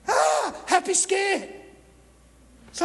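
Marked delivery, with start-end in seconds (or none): 0.1-1.5 slow, drawn out and higher, half laughing at himself; giddy and jittery at once; nervous glee. Acted. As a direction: stretch it like a giddy gulp. Sums up his feeling, performed.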